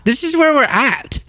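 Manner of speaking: exasperated pitch-up; rising disbelief. Real, not acted. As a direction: say it again, half laughing in disbelief. Repeated, higher.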